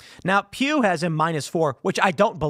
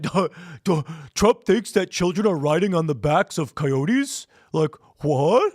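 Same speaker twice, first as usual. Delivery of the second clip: mock dumb voice. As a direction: play the clueless critic, dragging out the last what. Mocking Democrats' dumb reaction.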